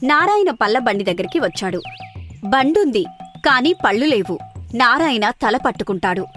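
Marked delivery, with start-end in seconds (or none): none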